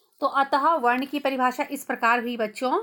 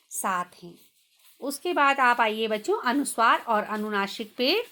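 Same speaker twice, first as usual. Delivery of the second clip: ordinary delivery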